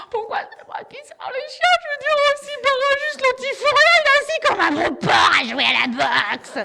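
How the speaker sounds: put-on high, loud whiny teenage complaint; whining teen girl. Teen daughter whining to mum about walking the dogs, high and loud. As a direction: grumble that the brother does nothing.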